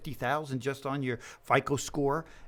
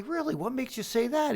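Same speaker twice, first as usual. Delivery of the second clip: higher, mock surprised question; retold surprise; 10 semitones higher than usual. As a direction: act surprised at the news. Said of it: "And I say".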